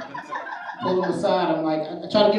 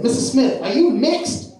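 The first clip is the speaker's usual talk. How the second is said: put-on higher-pitched questioning kid; seventh grader; 7 semitones higher, slower than usual. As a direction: imitate a student asking the teacher. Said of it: "I had a seventh grader".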